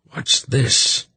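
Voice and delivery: sudden high-pitched surprise; startled. A narrator's reading. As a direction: caught off guard, pitch jumping up. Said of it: "he said in a startled voice".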